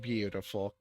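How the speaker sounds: usual speaking voice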